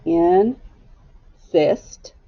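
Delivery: "Insist" drawn apart into in and sist; slow two-beat "Insist", one syllable at a time; split by syllable. Shown as a demonstration. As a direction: hold the in of "Insist", pause, then sist. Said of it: "let's scoop it".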